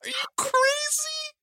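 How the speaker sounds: very high-pitched disbelief; incredulous. Real, not acted. Host protesting guest's dislike of her voice, incredulous. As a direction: squeal it in amazed protest.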